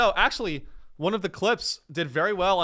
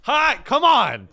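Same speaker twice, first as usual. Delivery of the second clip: high, loud and drawn out; exultant shout; 11 semitones higher, 7 dB louder, slower than usual. Real, not acted. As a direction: yell it, thrilled with your own pitch. Excitedly sells his movie idea.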